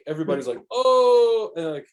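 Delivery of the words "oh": high, loud "oh" of the whole crowd; a crowd's impressed exclamation; mock gasp. Court crowd reacting to the jump, high excited "oh".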